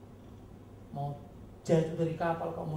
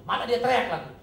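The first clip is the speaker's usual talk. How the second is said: loud preaching; 7 semitones higher, 9 dB louder than usual. Acted. Preaching at full force.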